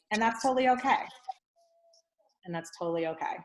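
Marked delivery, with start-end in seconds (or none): 2.4-3.4 softer repeat. Shown as a demonstration. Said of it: "it could be like".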